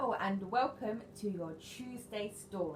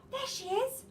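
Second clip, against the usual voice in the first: high relieved call; shouted relief; 4 dB louder than usual. Acted. Nicky shouts on spotting Mog in the rain. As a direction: spot her and call out. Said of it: "shouted Nicky".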